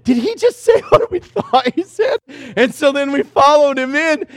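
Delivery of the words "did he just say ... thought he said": high, laughing what-did-he-say; amazed laugh mid-sentence; laughing disbelief. Retold or imitated. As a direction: laugh through the question. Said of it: "I was like".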